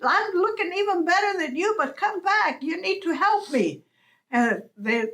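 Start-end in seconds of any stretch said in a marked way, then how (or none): 0.0-3.8 high yell after the flock. Acted. Coyote yelling at crows to come back, high call. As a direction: shout them back, pitched high. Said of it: "he yells".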